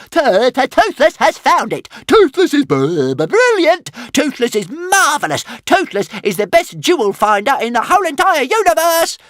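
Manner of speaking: high, squeaky, stammering glee; gloating little dragon. A narrator's reading. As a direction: crow with delight like a tiny proud dragon. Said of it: "gloated Toothless".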